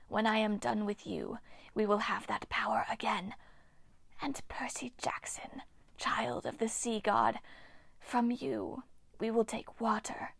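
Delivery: eerie breathy whisper of an ancient nymph; ghostly nymph whisper. Acted. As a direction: hiss it like a withered water spirit. Nymph Hagno speaks.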